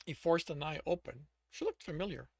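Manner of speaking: ordinary delivery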